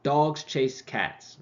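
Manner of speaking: slow, even beats on dogs, chase, cats; stress-timed demo. Shown as a demonstration. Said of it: "let's look at these sentences".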